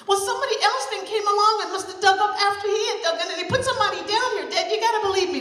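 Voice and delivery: put-on high, loud pleading child; high loud girl begging her dad; anxious girl. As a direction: convince her father, fast and urgent. Margaret's voice in story.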